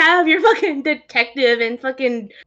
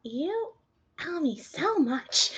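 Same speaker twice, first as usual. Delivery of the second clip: soft, slow aside; quiet; 6 semitones higher, 9 dB quieter, slower than usual. Acted. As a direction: breathe it out under the breath. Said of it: "Ismark just hears a".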